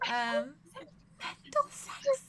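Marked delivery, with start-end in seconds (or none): 1.2-2.2 squealed. Acted. Jokey squeal of a phrase.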